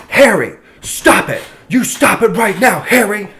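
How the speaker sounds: high, loud mock telling-off; retold scolding shout. Store worker confronting a troublemaker in a retold quarrel, scolding loudly. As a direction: act out a sharp, drawn-out order to stop.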